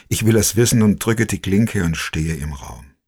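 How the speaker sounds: plain reading voice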